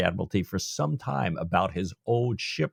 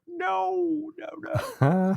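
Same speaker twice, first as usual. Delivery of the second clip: mock anguished no's, much higher; much slower than usual. Jack hearing his ship goes to Parker, high mock wail. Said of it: "I'm sure Jack's going like".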